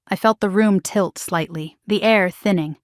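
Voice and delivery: usual speaking voice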